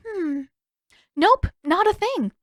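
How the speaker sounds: a lightly voiced cheeky Katara. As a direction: tease him breezily.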